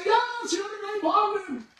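high, thin cheer of a crowd; be the whole crowd shouting back. Retold or imitated. Crowd cheering back at the tightrope walker, high. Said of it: "And they'd say".

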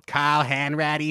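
slow, high Boston drawl on "Carl Hanratty"; Boston accent. Retold or imitated. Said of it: "the most ridiculous Boston accent".

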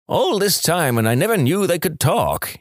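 high-pitched acted amazement; disbelieving farmer. Farmer learns his animals talk, amazed disbelief. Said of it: "can't believe what he's seeing".

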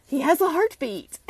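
high, loud imitation of the vet; quoted veterinarian. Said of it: "he checks out Jasmine's heart and he goes".

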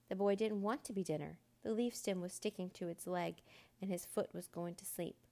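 ordinary delivery, nothing marked

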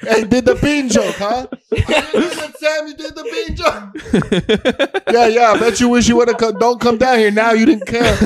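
high proud father calling out. Acted. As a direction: call the wife downstairs. Proud dad calling wife downstairs.

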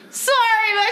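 high squealing shout of an apology; mock scream. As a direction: shriek the apology in a panic. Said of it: "And I just screamed".

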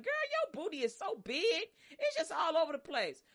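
high, mocking leering voice; leering coworker. Preacher voicing a coworker heckling a woman. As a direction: toss it off like a crude office guy. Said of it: "dude is like".

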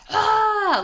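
high, loud football cheer; cheering yell. Retold or imitated. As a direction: shout like a fan at a touchdown. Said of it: "you just hear".

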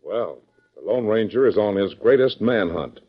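normal talking voice

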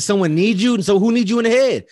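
loud, high, insistent retelling; outraged interrogation. Retold or imitated. Man demanding the hurt kid name his attacker, outraged. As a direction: build an indignant demand for the culprit.

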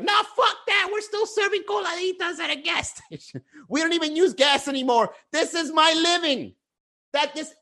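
high, shouted mock indignation; defiant Miami vendor. Miami coladita seller refusing to change for aliens, shouting high and indignant.

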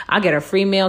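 ordinary talk, nothing marked

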